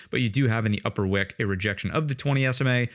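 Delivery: ordinary talk, nothing marked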